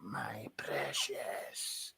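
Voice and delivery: slow, breathy creature whisper; creepy whisper. Pretend. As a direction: hiss it like a cave creature. Gollum line, whispered.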